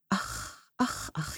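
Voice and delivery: zacht gefluister tegen de krant; gefluisterd gemompel. A narrator's reading. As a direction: binnensmonds verrukt fluisteren. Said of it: "mompelt ze". (moeder fluistert verrukt in zichzelf boven de krant)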